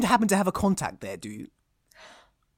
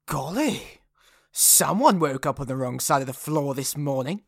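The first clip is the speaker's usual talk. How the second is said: usual speaking voice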